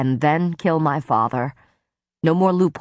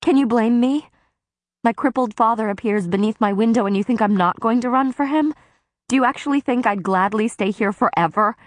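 higher, fast, indignant outburst; blurted outburst; 5 semitones higher than usual. Acted. Feyre lashing out at Tamlin about her father, indignant. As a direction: let it spill out, higher and hurried. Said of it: "I blurted".

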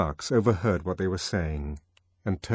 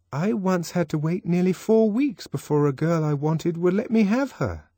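schoolboy; 10 semitones higher, 3 dB louder than usual. Acted. Boy answering the Controller.

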